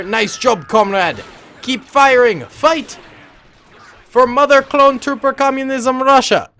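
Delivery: loud, high mock-Russian battle cry; badly faked Russian accent, building to a shout. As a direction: cheer on the troops as a hammed-up Russian comrade. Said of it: "I cannot do a Russian accent".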